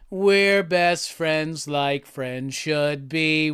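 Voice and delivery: slow, low, sung Barney impression that stays close to own voice; a hint of the purple dinosaur, sung slowly; lightly voiced Barney. Streamer tries the Barney voice for chat, slow and sung. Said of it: "it's kinda like".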